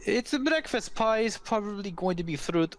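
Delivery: friendly host offering food, unsure of details; hesitant Laszlo. Acted. Laszlo offers travelers breakfast pie, hesitant and high. Game master voices Laszlo.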